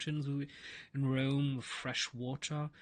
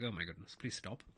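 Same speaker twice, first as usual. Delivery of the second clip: weary giving in; 6 semitones lower, 8 dB quieter than usual. Retold or imitated. Imagined person giving in.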